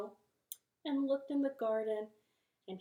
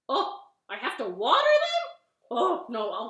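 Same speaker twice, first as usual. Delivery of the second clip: startled dismay; 3 semitones higher, 11 dB louder than usual. Acted. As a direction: react with big acted surprise. Toad learns he must water.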